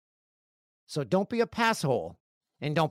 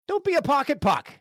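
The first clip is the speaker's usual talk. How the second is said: high, loud heckle; mock scolding; 12 semitones higher, 5 dB louder than usual. Host demonstrating the new insult, mock scolding shout. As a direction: yell it like a taunt across the rink.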